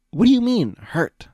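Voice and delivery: high, loud shock. Acted. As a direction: blurt it out in disbelief. Big Mac shocked.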